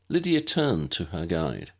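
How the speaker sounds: ordinary delivery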